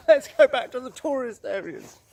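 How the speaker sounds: high, loud mock panic; mock scared horse. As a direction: play the horse bolting back in fright. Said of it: "The horse goes".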